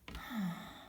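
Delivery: soft, falling sigh of relief; a long easing exhale; big relieved sigh. Acted. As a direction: let all the tension out in one breath. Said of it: "she lets out a big sigh".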